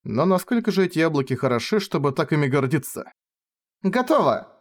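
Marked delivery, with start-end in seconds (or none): 3.8-4.5 bright, much higher call; loud announcement. Acted. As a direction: announce it proudly. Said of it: "громко объявила Ренге".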